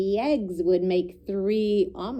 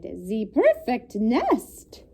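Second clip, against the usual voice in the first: slow, louder put-on duck voice; 4 dB louder, much slower than usual. A narrator's reading. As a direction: savour it like a smug duck. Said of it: "she cried".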